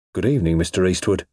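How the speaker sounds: ordinary delivery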